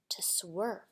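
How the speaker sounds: dropped to a near whisper; hushed suspense. A narrator's reading. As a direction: let it fall away, soft and low. Suspense in story read aloud.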